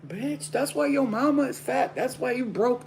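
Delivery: a hater insulting her mama; taunting hater. Pretend. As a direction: jeer it in her face. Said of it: "imagine the haters ... like".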